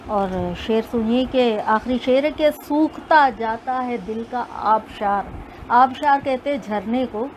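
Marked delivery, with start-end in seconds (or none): none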